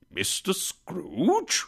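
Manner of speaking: slow, drawn-out, high rising question; astonished question. A narrator's reading. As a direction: stretch the name out in disbelief. Old gentleman astonished to be greeted.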